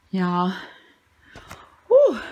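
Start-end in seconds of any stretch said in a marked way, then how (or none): 1.9-2.2 high loud exhale whew; out of breath. Real, not acted. Winded from busting the floor tiles, loud whew. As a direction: blow out hard, winded. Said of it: "I'm out of breath".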